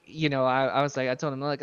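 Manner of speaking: ordinary talk, nothing marked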